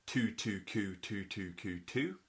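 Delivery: tu tu ku tu tu ku tu spoken as separate syllables; softly spoken syllable demo; reading tonguing syllables. Shown as a demonstration. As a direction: read tu tu ku tu tu ku tu one syllable at a time. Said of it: "you see the syllables".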